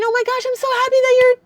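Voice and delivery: high, fast, syrupy sweet pretend wife; mock gushing relief. Wife mocking the relieved greeting her husband expected, gushing high and fast. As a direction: gush it out like the relieved wife she wasn't. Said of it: "And instead of being".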